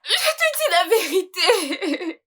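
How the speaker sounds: high, tearful cry; crying plea. Acted. Wife pleading to be believed by husband, crying out. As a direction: wail it out in desperate protest.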